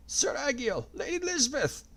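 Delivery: put-on high old man calling out; elderly voice. A narrator's reading. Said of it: "an elderly voice called out".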